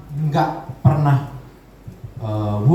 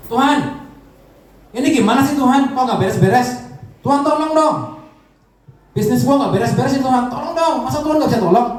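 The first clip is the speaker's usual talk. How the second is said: higher, louder, whining at God; whiny complaining prayer; 8 semitones higher, 3 dB louder than usual. Acted. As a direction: demand help like a frustrated believer.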